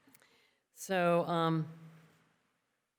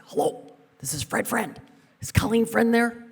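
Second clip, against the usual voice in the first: higher, louder formal phone greeting from dad; 9 semitones higher, 8 dB louder than usual. Retold or imitated. Imitating her dad calling the apartment, higher and louder. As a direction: put on your father's polite caller voice. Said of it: "and he'd say".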